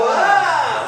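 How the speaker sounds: high loud playful yelp; loud mock alarm to refuse it; rejecting shriek. Shown as a demonstration. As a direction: push the energy back with a cry. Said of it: "if you don't want it, you go".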